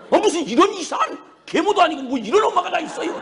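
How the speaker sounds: high-pitched, indignant harangue at mother; worked-up reproach, pitched high; acted scolding. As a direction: reenact a son's exasperated outburst. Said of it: "막 야단을 치면".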